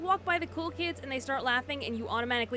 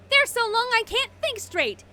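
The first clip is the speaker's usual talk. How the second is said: loud, high, drawn-out mock despair; hammed-up protest cry; 13 semitones higher, 8 dB louder than usual. Student protesting too-long uniform skirts, hammed-up loud high outcry.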